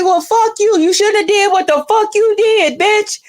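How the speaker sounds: high, defiant yelling back; mock angry yell. Her younger self yelling at him from behind the locked door. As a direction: shout it through the bathroom door.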